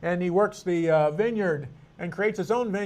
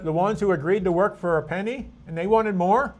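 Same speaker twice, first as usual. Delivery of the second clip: ordinary delivery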